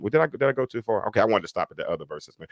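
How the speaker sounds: usual speaking voice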